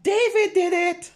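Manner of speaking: high loud tattling cry; accusing shout. Acted. Book title read in character.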